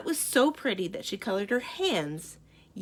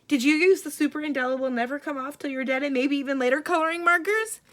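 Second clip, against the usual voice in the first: fast, loud frantic rush; 6 semitones higher, 7 dB louder, faster than usual. Acted. Mother demanding which markers, fast and frantic. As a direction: rattle it off in alarm.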